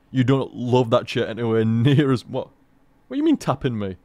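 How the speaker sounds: ordinary talk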